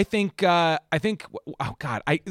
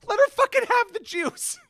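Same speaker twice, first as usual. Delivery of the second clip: much higher, mock outraged; exasperated squeal; 4 dB louder than usual. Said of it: "I'll be like".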